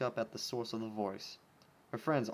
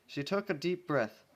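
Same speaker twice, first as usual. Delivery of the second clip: usual speaking voice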